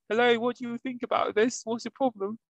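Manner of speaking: high, put-on British accent as a kid interviewer; hammed-up British accent interview; mock British interviewer. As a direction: play a child faking a British reporter. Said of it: "trying to interview her".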